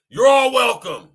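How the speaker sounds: loud smug movie-quote impression; imitating the gangster. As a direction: ham up the gangster's smug welcome.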